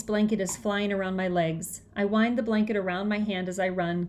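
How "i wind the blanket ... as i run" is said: ordinary delivery, nothing marked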